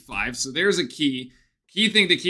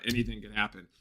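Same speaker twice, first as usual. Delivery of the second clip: ordinary talk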